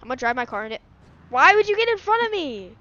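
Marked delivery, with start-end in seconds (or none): 1.3-2.7 loud, high-pitched complaint; high, loud exasperation at a friend; indignant shout. Real, not acted. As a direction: yell an accusing question. Blames friend for crash.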